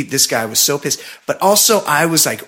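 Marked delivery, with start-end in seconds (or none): none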